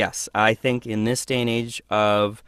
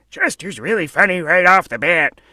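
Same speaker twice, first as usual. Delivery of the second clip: high, loud character voice; loud, high cartoonish impression; comic alter voice; 7 semitones higher, 7 dB louder than usual. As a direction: put on a comic character, pitched up and pushed. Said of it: "some of the other".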